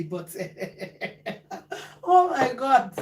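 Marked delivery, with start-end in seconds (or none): 0.4-2.0 quiet stifled laugh; laughing under the breath despite herself; breathless giggle. Real, not acted. Vlogger to viewers, a stifled breathless laugh. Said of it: "Sorry that I'm laughing".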